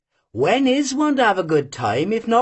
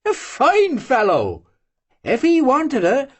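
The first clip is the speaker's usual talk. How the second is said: usual speaking voice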